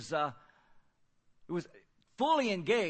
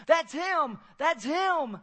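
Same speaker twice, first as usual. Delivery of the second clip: high, loud, thrilled shouting; cheerleader excitement; 10 semitones higher, 8 dB louder, much slower than usual. Retold or imitated. Wife spots the man from her dream, excited cheer. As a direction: cheer it like spotting the star player. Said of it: "dancing like a cheerleader on the sideline".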